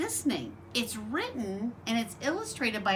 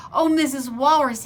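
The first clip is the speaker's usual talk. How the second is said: louder, eager call; crying out; 3 semitones higher, 10 dB louder than usual. Acted. Little bird Choco calling to Mrs Walrus, loud and eager. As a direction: call out to her with hope. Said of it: "he cried".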